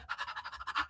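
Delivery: airy acted gasp; shocked gasp. Passenger choking on gummy bears, acted shocked gasp. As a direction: suck in air as if choking. Acting out shock while watching.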